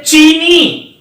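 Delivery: चीनी said slowly with the weight on the long नी; stressed demo. Shown as a demonstration. Teacher shows the long ई to children: slow, stressed चीनी. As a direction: press down on चीनी and stretch it. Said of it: "जोर देके बोलना पड़ेगा".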